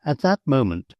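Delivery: ordinary delivery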